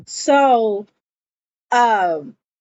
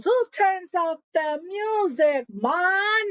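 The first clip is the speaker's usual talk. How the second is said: put-on high-pitched drunken whine of protest; imitating a drunk reveler; drunk party guy; 9 semitones higher than usual. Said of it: "He's so drunk".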